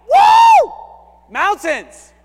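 whooping high and loud with glee; excited whoop. Retold or imitated. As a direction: cheer like seeing peaks. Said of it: "I'm thinking like".

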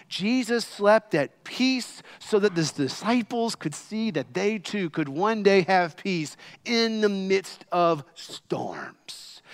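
usual speaking voice

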